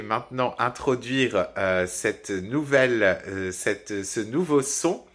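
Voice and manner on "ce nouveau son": ordinary talk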